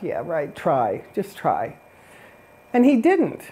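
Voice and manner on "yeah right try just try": low, soft scoffing dare; mocking dare. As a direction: brush off the threat with a quiet sneer. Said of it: "And they kinda laughed".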